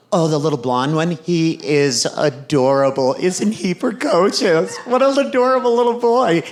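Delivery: lightly voiced mom fussing over a little one; gushing mom.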